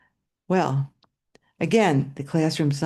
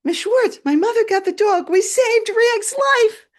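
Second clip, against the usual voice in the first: put-on much higher, louder proud kid voice; excited boy; 7 dB louder than usual. Boy telling his teacher his mother saved the dog, excited. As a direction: burst in with good news. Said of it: "and he says".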